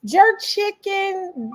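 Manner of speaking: drawn-out, high, relishing; lingering over a favorite dish; savoring. Real, not acted. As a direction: stretch it like you can taste it. Listing foods he loves.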